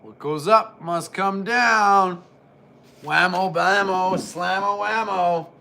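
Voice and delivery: slow loud ringside announcer call; wrestling commentator. Acted. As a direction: belt it out like ring announcer. Announcer calls the slam.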